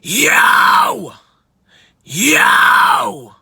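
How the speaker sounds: loud, high harsh scream. Shown as a demonstration. Coach showing own high register, high scream. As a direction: blast it out high and raspy. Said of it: "my high would be".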